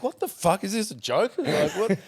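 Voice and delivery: high-pitched what the fuck, is this a joke; drafted kid hearing Tim Watson is calling; stunned disbelief. Retold or imitated. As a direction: squeak out disbelief at the phone call. Recruiter calls.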